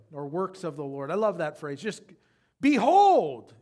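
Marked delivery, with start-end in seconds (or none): 2.6-3.5 loud, high proclaiming "behold"; "behold" thrown out like a wake up shout; wake-up call. Shown as a demonstration. Preacher calling the church to wake up, loud "behold". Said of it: "behold is kinda like wake up".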